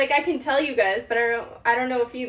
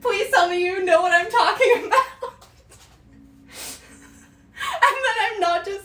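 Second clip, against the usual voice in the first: higher and louder, pleading through laughter; laughing plea; 8 semitones higher, 6 dB louder than usual. Real, not acted. Begging viewers to know the Vine, laughing hard. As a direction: beg them to know it, giggling.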